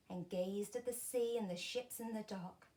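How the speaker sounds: ordinary delivery, nothing marked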